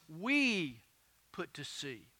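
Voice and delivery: "We" held long, pause, rest dropped soft; stress on WE. Shown as a demonstration. Preacher shows Luke traveled with Paul, stress on WE. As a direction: isolate WE, then let "put to sea" fall away. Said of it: "Notice it was not just Paul".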